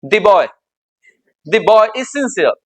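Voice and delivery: put-on "the" said as a stressed "thee" before boy; slow, pointed "thee boy is sincere"; emphatic the. Shown as a demonstration. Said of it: "दी ही बोला जाएगा emphasise करने में".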